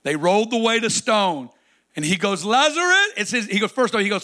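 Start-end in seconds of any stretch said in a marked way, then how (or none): 2.4-3.1 high and loud call of Lazarus. Acted. Jesus calling his dead friend out of the tomb, loud.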